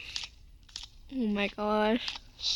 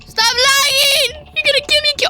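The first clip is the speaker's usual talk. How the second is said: frustrated yell; 8 semitones higher, 7 dB louder than usual. Real, not acted. Game lagging, chased.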